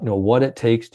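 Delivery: ordinary talk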